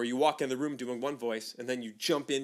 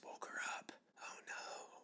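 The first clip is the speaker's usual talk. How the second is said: hushed, guilty whisper; 18 dB quieter than usual. Real, not acted. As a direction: whisper it so the sleeper stays asleep. Avoids waking the snoring dog.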